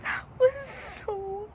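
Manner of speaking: high, slow, crying voice over the book; real tears breaking through the words; near tears. Real, not acted. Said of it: "I'm gonna cry".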